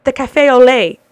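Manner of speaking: pointing at the menu, fed up and loud; frustrated retold insistence. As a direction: insist on it at full volume. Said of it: "And I'm like".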